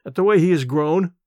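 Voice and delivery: plain reading voice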